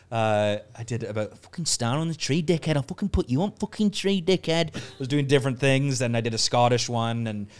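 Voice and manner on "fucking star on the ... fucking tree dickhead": slightly raised Mancunian voice; Mancunian accent. Retold or imitated. Showing off a Christmas video, Mancunian accent. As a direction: grumble threats in Mancunian. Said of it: "Mancunian where I was like".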